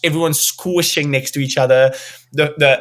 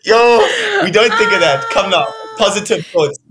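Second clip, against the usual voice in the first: much higher and louder, laughing objection; playful protest; 9 semitones higher, 5 dB louder than usual. Real, not acted. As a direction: shoo away the gloomy thought with a grin. Laughing protest at pessimistic host.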